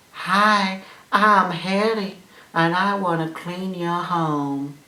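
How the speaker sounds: high, slow put-on vacuum cleaner voice; Hetty's accent. Vacuum cleaner greeting its buyer, high drawn-out character voice. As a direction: introduce yourself as a slow, high-voiced cartoon appliance. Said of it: "I don't know why Hetty has that accent".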